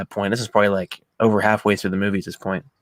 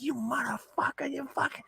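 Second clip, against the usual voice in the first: imitated fury; 13 dB quieter than usual. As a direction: act enraged with the volume held right down. Acted enraged attack, held back.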